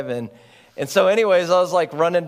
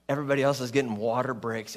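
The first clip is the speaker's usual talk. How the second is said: ordinary delivery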